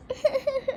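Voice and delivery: high, soft stuttering sobs; put-on boo-hoo heard from another room; distant crying. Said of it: "Why do I hear crying?".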